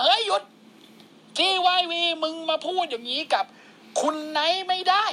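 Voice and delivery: high mock-stern call to stop; indignant intervention. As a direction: step in and defend your boss, pitched well up. Acted servant steps in to defend boss.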